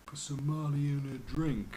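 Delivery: high, slow mock rapper voice; hammed-up rapping of the lyric; mock rapper delivery. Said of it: "'cause of one lyric going".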